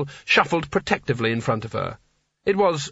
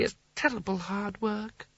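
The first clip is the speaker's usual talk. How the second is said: put-on higher, softer weary old ruler; a touch of the tipsy Seraph; 7 semitones higher, 9 dB quieter than usual. A narrator's reading. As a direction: sigh it like a tired old king. Seraph complaining.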